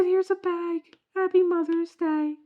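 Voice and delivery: high, loud mock-sweet clerk. Store clerk handing the mom a bag, high and sweet. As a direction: chirp it kindly, way up high. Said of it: "He said".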